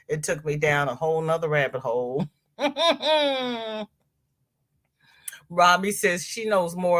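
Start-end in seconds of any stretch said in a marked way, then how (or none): 2.6-3.8 high closed-mouth giggle; amused chuckle. Real, not acted. Streamer amused at her rabbit hole, humming chuckle. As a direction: hum out a short high laugh.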